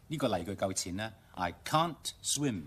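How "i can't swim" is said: I can't swim said slowly, can't as kahnt; slow, careful British I can't swim; slow British reading. Shown as a demonstration. Teacher modelling the British sentence, slow reading. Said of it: "讀快啲".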